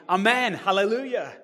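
loud, high amen, hallelujah; amen cry. Acted. Preacher voicing the elders' cry, loud and high. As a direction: cry it out in worship.

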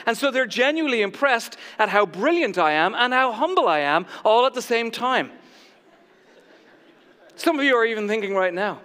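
usual speaking voice

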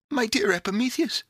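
put-on high, eager girl's call; crying out. A narrator's reading. Pandora calls to Epimetheus in the corner, crying out. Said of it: "cried Pandora".

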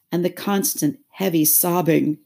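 plain reading voice

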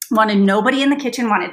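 ordinary delivery

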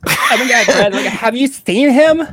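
high, laughing put-on girl voice; female Valley Girl. Shown as a demonstration. Girlfriend asking if you've seen Brad, high Valley Girl. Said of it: "trying to nail, like, the good female".

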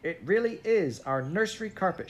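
high-pitched, earnest protest; insistent child. A narrator's reading. Jane pushes forward to claim the carpet, insistent. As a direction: press the point in a high voice.

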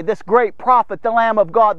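ordinary talk, nothing marked

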